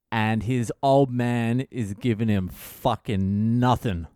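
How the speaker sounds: usual speaking voice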